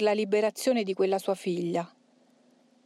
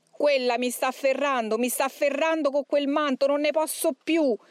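higher, rising desperate demon voice; anguished demon; 6 semitones higher than usual. A narrator's reading. Demon seized by the Madonna's mantle, rising anguish. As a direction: build to the edge of endurance. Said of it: "con rabbia e singhiozzando. Poi continuò".

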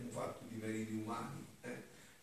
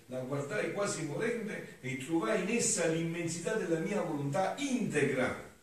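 raised, loud declaiming voice; higher-pitched oratorical reading aloud; solemn devotional reading; 8 semitones higher, 9 dB louder than usual. Acted. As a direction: declaim the sacred text with fervour. Reads devotional text aloud.

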